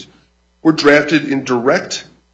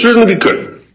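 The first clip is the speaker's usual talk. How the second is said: Certainly we could said higher and louder to be heard; louder repeat; 10 semitones higher, 6 dB louder than usual. Real, not acted. Lawyer repeating his answer to the justice, louder and higher. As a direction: raise the voice and restate it firmly over the bench.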